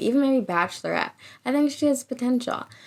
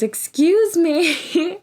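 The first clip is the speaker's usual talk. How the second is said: slow, much higher and louder mock wounded voice; hammed-up choked excuse me; tearful excuse me; 8 semitones higher, 5 dB louder, much slower than usual. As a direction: imitate a hurt girl holding back tears. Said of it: "She goes".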